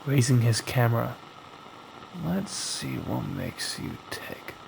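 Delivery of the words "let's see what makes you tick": low, much softer, half-whispered dare; hushed whisper. Acted. Thomas daring the haunted mirror, low hushed voice. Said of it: "Thomas whispered".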